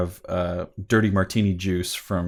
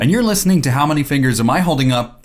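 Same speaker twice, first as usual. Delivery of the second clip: announcer voice; 5 semitones higher, 5 dB louder than usual. Acted. Recorded show intro.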